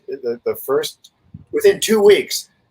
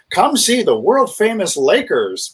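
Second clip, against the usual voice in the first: slow, higher-pitched promo announcer; advertising pitch; 5 semitones higher, slower than usual. Retold or imitated. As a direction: pitch it like a team advert, drawing out the names. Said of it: "when he was advertising. He would always say".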